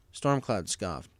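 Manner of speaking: ordinary delivery, nothing marked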